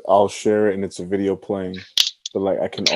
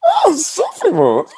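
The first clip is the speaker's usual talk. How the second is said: high mock squeal; 3 dB louder, much slower than usual. Mocking selfie mode.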